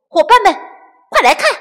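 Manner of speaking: loud high call to friends. Acted. Little chick calling friends over to look. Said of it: "小六子招呼大家".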